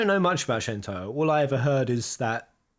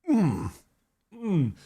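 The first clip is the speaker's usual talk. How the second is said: ordinary talk, nothing marked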